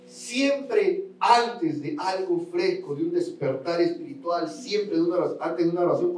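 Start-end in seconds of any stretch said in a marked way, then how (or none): none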